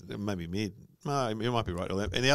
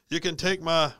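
higher mock Scottish accent; Mel Gibson; 8 semitones higher than usual. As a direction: put on a Braveheart Scottish accent. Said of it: "what does he say?".

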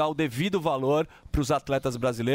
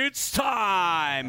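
ring announcer shout; 3 semitones higher than usual. Pretend. Fight announcer hype call.

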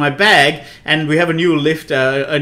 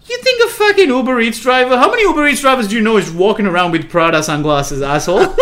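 mock ego rant; 6 semitones higher than usual. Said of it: "my ego kicked off".

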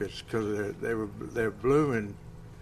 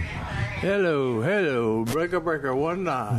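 CB radio call sign, loud and raised; over walkie talkie; 4 semitones higher, 4 dB louder, slower than usual. Pretend. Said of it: "Say something for me".

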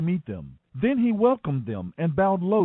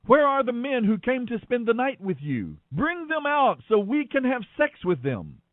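higher pitched, a mild mock shout from the crowd; mob shouting; 7 semitones higher than usual. A narrator's reading. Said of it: "They shouted to Lot".

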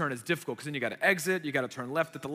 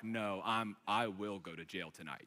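low, hushed warning; quiet threat; 9 semitones lower, 11 dB quieter than usual. Retold or imitated. Driver warns the man aiming a milkshake, low quiet threat. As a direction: drop it right down, deadly restrained.

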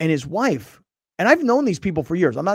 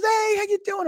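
fake-friendly greeting. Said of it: "he goes".

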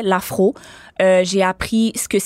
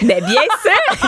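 laughing; 10 semitones higher, 4 dB louder, slower than usual. Real, not acted. Proud answer, laughing.

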